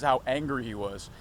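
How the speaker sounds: normal talking voice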